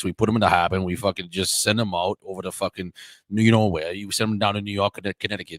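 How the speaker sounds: put-on low, gruff Boston accent, picking a fight; a Southie tough guy mocking him in a Boston accent. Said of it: "we're just doing Boston, Boston accents".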